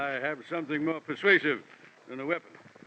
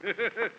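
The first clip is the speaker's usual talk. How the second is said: higher, rippling self-satisfied chuckle; amused chuckle; 5 semitones higher than usual. Acted. Villain enjoying the investigator's surprise, amused chuckle.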